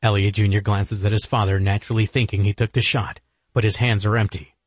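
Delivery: usual speaking voice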